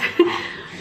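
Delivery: small embarrassed laugh through tears; teary laugh. Real, not acted.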